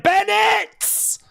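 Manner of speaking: high yelled name; excited call. Real, not acted. Streamer yells Bennett's name in excitement. Calling out wrestler.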